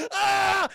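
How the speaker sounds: high, loud cry of pain; pained scream. Retold or imitated. Man grabbed by the claw, pained scream. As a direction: yell out as your hand is crushed. Claw crushes the man's hand.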